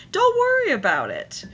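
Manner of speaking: high airy brush-off; dismissive wife. Retold or imitated. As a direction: wave it away in a high voice. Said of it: "that's how she responds".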